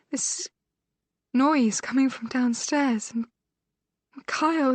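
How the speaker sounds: injured sister telling her sister about the night of the attack, distraught; distressed sister, recounting. A narrator's reading. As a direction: relive the horror as it breaks her.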